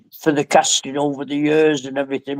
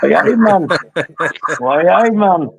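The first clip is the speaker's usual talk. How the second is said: put-on Geordie accent; 3 semitones higher than usual. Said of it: "learn a Geordie how to speak".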